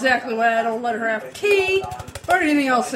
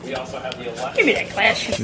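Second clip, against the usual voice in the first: mock stern; 11 semitones lower, much slower than usual.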